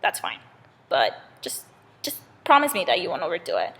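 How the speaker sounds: plain reading voice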